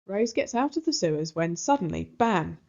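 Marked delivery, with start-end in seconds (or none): none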